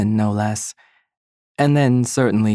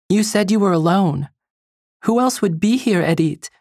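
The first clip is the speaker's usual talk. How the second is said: questions pushed up higher, pressing; higher voice, the words tumbling out without thinking; alarmed rush of questions, pitched up; quick and high; 7 semitones higher than usual. Acted. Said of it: "She speaks quickly, without thinking, her voice high".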